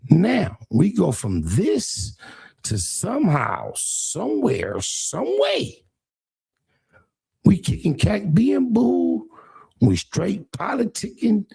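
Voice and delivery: rising, sarcastic incredulity; mock how far things went; mocking disbelief. Real, not acted. Mocking a former friend's turnaround, rising disbelief.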